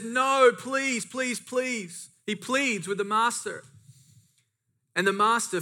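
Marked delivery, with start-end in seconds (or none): 0.0-2.1 higher-pitched mock begging; pleading. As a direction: act out a servant begging for mercy. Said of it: "he pleads and he says".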